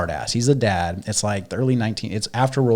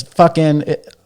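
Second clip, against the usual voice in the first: higher and louder, a father scolding his son; angry father rant; 6 semitones higher, 6 dB louder than usual. Retold or imitated. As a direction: rant at the kid without stopping. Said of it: "And then he goes".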